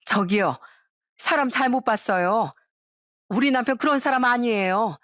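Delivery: understated protective protest; defensive, shielding someone. A narrator's reading. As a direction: stick up for your partner insistently. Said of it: "그놈을 또 감싸며 이러더라고요".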